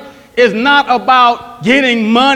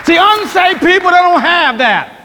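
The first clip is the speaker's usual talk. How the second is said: higher, stressing unsaved; preaching emphasis; 3 semitones higher than usual. Acted. Pastor warning the church about unsaved people, emphatic preaching. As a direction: lean hard on unsaved.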